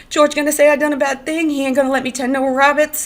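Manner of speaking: high, loud wailing plea; crying with fright. A narrator's reading. Lenny fearing George's verdict, frightened wail. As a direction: wail it in childlike fear. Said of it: "began to cry with fright".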